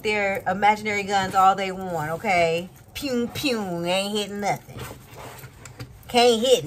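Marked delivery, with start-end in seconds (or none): 2.9-3.8 higher-pitched "pew, pew" gun noises; mock gunfire. Mocking enemies' imaginary guns, playful shooting noises. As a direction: imitate toy laser shots.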